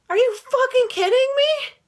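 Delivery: high, loud furious disbelief; outraged disbelief. Real, not acted. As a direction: let the outrage explode upward. Outraged at the mechanic's upselling.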